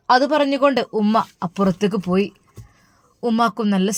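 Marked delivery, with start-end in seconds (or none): none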